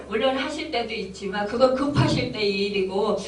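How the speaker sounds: normal talking voice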